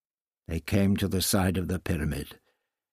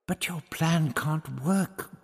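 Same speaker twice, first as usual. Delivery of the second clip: slow, higher worried protest; protesting Barbara; 7 semitones higher, slower than usual. Acted. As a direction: Barbara pushing back, a touch higher. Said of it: "Barbara protested".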